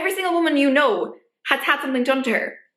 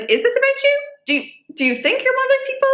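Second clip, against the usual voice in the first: incredulous question; 10 semitones higher, faster than usual. Real, not acted. Challenging the listener.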